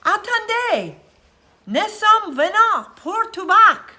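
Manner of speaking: loud, high, slow bellow in French; a boy shouting across to French fishermen. A narrator's reading. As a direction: bellow across the water to the other ship. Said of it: "and he bellowed".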